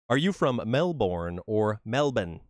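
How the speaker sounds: first "Melbourne" MEL-born with R, second "Melbourne" MEL-bn clipped; both pronunciations contrasted. Shown as a demonstration. Teasing an Australian viewer, two Melbourne pronunciations. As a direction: set American "Melbourne" against Australian "Melbourne". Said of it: "You say Melbourne".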